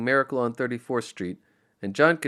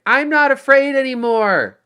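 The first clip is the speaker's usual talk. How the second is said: much higher, loud defiant yell; 12 dB louder than usual. Acted. John yelling defiance up at the trees: a loud yell. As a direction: bellow it at the sky. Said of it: "yelled up towards the skeletal tree".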